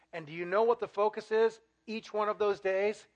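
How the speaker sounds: usual speaking voice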